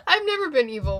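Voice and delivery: high, loud laughing words. Real, not acted. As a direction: laugh through the line. Laughs at own supervillain talk.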